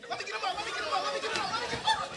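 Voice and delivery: frantic shout. Acted. Panicked parent scrambling to lift his baby, shouting. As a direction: rush to pick the baby up.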